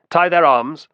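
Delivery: loud, high-pitched command; brisk order. A narrator's reading. Optio to his men disarming the pair, brisk order. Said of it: "said the Optio briskly".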